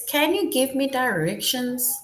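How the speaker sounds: teacher reading a question to grade threes; question read high. Shown as a demonstration. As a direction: lift the voice for a question. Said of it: "is that a question that is being asked".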